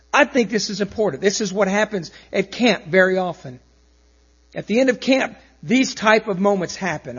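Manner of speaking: ordinary delivery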